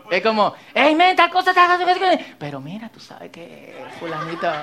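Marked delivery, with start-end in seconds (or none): 0.7-2.2 high excited gossiping. Retold or imitated. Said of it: "La gente que cuenta chisme". 2.4-4.5 hushed, giggly gossip; tittering gossip whisper. Retold or imitated. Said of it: "Tono de chisme".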